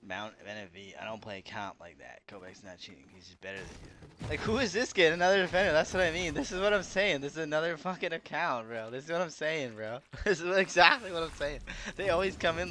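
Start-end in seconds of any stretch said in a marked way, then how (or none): 4.3-12.7 raised, louder and higher, triumphant; loud call-out. Real, not acted. Streamer loudly calling out another defender in chat. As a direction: loudly point out he was right.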